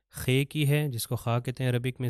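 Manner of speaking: ordinary delivery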